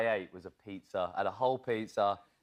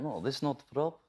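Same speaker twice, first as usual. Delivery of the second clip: retold actor speaking in Russian accent; Russian accent, broken English; in-character Russian accent; 3 semitones higher than usual. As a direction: imitate a terse Russian-accented correction. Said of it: "he stays in character".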